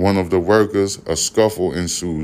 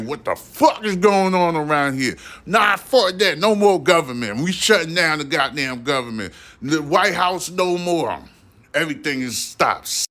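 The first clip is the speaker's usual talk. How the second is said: much higher pitched, ranting god losing patience; a fed-up creator snapping at humanity, pitched way up; 9 semitones higher than usual. Pretend. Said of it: "He's gonna be snapping".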